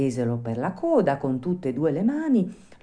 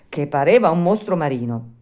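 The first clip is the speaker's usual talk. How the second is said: ordinary delivery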